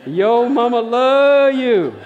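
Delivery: high, drawn-out holler; imitated yell. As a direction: mimic a woman bellowing across an auditorium, slow and stretched. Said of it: "this woman yells out".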